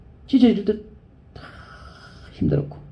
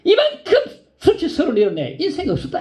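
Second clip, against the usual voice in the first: high, wounded outburst then sinking; ashamed mother cries out on the train; hurt mother; 12 semitones higher than usual. Retold or imitated. Humiliated mother on the way home to Busan, high and wounded. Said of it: "크게 상처받은 엄마가 말하기를".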